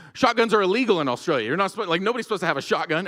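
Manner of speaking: loud, high and rushed disbelief; indignant alarm. Retold or imitated. Australian insists shotguns illegal.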